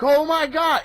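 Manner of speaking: as if the twist just landed; stunned exclamation. Retold or imitated. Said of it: "and you're like".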